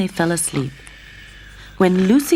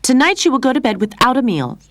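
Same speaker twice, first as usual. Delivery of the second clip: angry; 8 semitones higher, 5 dB louder than usual. A narrator's reading. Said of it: "Lorraine said angrily".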